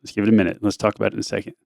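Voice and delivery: put-on low, soft and calm, like settling a hurt kid; soothing dad voice. Dad soothing injured daughter at basketball game, low and calm. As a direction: gently talk your crying daughter down, unhurried.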